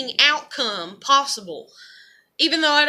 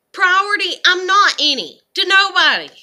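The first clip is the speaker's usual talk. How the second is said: louder and higher, hurt and angry; bitter outburst; 6 semitones higher, 8 dB louder than usual. Real, not acted. Complaining nobody prioritizes her, bitter louder outburst. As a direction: spit out the word Priority.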